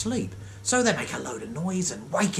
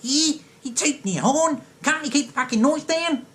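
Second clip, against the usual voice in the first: hammed-up Australian bloke, pitch way up; Australian tradie snapping about the horn, high and cheeky; Aussie tradie; 11 semitones higher than usual. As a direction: play the aggrieved Aussie mate. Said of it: "Or if they're Australian, they go".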